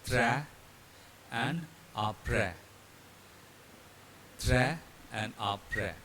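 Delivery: "tra" and "après" ending in the open "bat" vowel, silent S; French pronunciation demo. Shown as a demonstration. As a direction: slowly model "tra" and "après", no final S. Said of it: "They are pronounced as".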